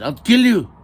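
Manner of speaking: very loud, high screamed threat; shouting. Acted. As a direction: scream the threat at full force. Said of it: "he shouted".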